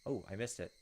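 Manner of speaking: ordinary talk, nothing marked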